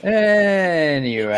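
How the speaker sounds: drawn-out segue. Real, not acted. Host moving on after being teased, long drawn-out word. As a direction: stretch it right out to change the subject.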